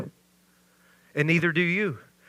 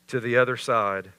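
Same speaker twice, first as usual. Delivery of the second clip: normal talking voice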